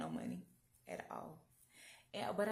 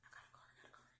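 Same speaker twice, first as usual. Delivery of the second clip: whispered glee, barely audible; 21 dB quieter, much faster than usual. Retold or imitated. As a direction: whisper it to yourself in disbelief. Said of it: "I was like".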